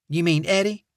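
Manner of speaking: sweet Southern receptionist voice; Southern accent. Acted. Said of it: "saccharine Southern accent".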